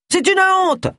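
high-pitched indignant cry; high, scandalised exclamation; outraged protest. A narrator's reading. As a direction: call out your outrage from the audience.

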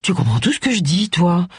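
mildly stupefied question; a touch of amazed disbelief; stunned murmur. A narrator's reading. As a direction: ask it in understated astonishment. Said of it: "murmura-t-elle stupéfaite".

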